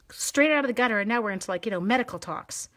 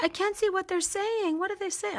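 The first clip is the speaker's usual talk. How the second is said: squeaky high fretful voice; high mock panic; 11 semitones higher than usual. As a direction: fret like a teenager, squeaky. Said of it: "I'm like".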